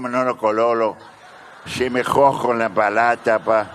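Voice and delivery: put-on Mexican accent, half laughing; sling it in jokey Mexican-accented Spanish; mock Mexican accent. Lottery-winning Mexican turning the money down, mock Mexican accent. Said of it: "A Vietnamese or a Mexican".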